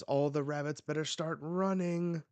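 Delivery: taunting announcer. Acted. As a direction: call it out to start the hunt. Voicing hunt announcer from manga.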